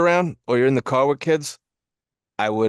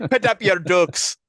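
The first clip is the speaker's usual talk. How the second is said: mock Irish accent; 3 semitones higher than usual. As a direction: put on a playful Irish brogue. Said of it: "a nice, a nice Irishman".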